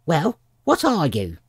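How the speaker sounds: high, loud challenge; sharp bird interrogation; demanding pigeon. A narrator's reading. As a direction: press for an answer impatiently.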